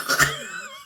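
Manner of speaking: a burst of high, squealing laughter. Real, not acted. Vlogger cracking up at the story, high squealing laugh. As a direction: laugh high and hard at the comeback.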